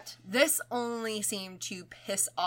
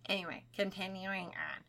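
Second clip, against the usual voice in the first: ordinary talk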